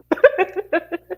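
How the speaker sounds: high-pitched laughing fit; real bubbling laugh. Real, not acted. Still laughing.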